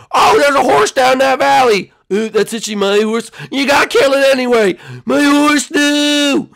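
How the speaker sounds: high, loud hammed-up alarm; urgent shouting. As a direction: cry out over the valley, high and loud. Acted shouting over horse in valley.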